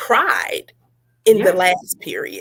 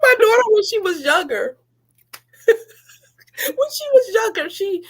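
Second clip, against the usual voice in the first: much higher, slow, laughing; laughing through words; 12 semitones higher than usual. Real, not acted. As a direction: laugh as you try to speak. Laughing while telling.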